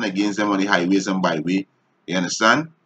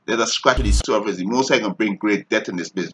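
ordinary delivery

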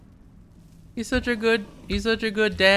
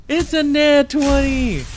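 loud, drawn-out thrilled cry; slow excited shout; 4 semitones higher, 5 dB louder, slower than usual. Real, not acted. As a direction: stretch it out, still amazed. Repeats the roll, slower.

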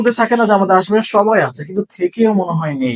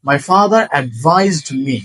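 very slow, word by word for learners; unhurried teacher example; slow model sentence; 3 semitones lower, much slower than usual. Shown as a demonstration. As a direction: lay out each word clearly. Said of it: "তাহলে".

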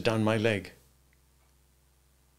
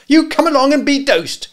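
piercing high, loud scolding order; awful command; 11 dB louder than usual. A narrator's reading. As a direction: order the boy off sharply at full voice. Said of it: "the awful words".

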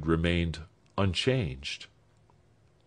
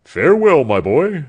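booming, much louder goodbye; old doctor bidding the young man a loud farewell; hearty farewell; 6 semitones higher, 10 dB louder than usual. Acted. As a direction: call it out warmly.

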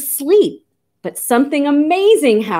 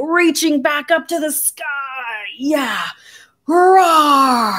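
stretching bear voice climbing to a roar; playful high, roaring bear; big old bear; 5 semitones higher, slower than usual. Pretend. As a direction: reach up high, then let out a big roar. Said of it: "I pretend to be a bear".

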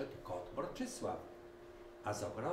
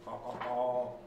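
hen clucking; 2 semitones higher, 5 dB louder than usual. A narrator's reading. Hens admiring the car, lightly clucking. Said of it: "zagdakały kury".

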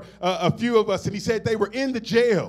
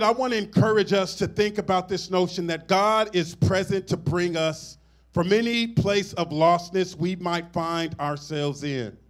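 ordinary talk, nothing marked

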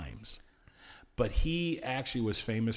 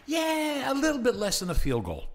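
much higher, hammed-up sly bookie voice; Jimmy the Greek; 13 semitones higher, 4 dB louder than usual. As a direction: wink the hint past the censors. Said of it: "he would sit there and goes".

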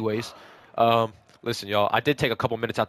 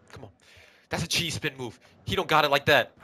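ordinary talk, nothing marked

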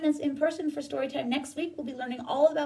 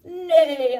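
loud horse neigh; 2 semitones higher, 10 dB louder than usual. Acted. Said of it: "said the horse".

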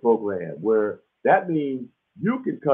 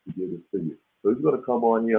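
normal talking voice

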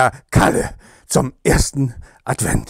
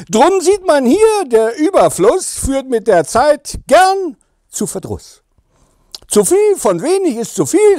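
higher, singsong verse delivery; poem recital; 6 semitones higher than usual. Acted. Kalle closes his Advent verse for fans, poem recital. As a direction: lift the rhymes high, like a mock-solemn moral.

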